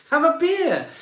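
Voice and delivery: a cheery laughing call to just have a beer; excited laughing. Real, not acted. Host urging stressed viewers to relax, laughing and excited.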